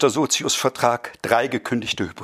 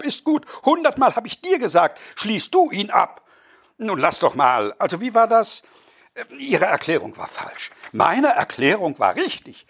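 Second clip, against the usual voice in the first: high, excited squabbling voices; raised voices of a quarrel over cheese; quarrelling dinner guests; 9 semitones higher than usual. Acted. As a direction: bicker like affronted bourgeois guests. Quarrel continues.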